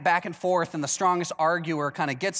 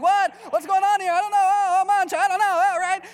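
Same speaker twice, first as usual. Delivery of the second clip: hammed-up Italian accent, much higher and louder; comic Italian exclamations, pitched way up and shouted; 12 semitones higher, 6 dB louder than usual. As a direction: throw up your hands and ham up a loud Italian squabble. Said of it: "like a stereotypical Italian".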